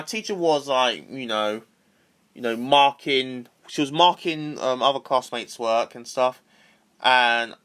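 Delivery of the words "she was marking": ordinary talk, nothing marked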